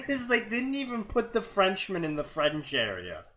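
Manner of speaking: high, giggling through the words; amused disbelief bubbling into laughter; laughing. Real, not acted. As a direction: laugh your way through the punchline. Laughing at the fic's mistake.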